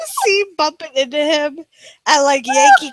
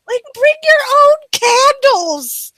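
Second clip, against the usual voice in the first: exasperated; 7 semitones higher, 4 dB louder than usual. Real, not acted. Mock exasperation at candle requests.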